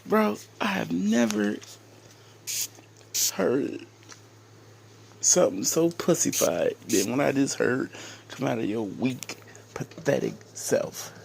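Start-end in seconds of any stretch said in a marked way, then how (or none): none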